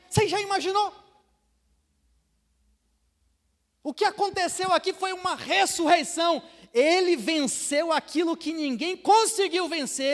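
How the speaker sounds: loud, high, fervent sermon voice; full-force preaching. Acted. Pastor preaching victory to the church, loud and high. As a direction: thunder it out to the crowd.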